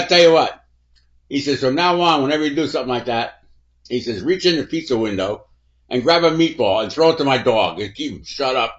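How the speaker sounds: booming mock-stern instructions; loud scolding. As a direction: lay down the law at full volume. Chipper scolding the cop loudly.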